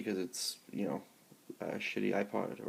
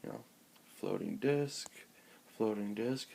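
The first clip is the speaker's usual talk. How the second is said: ordinary talk, nothing marked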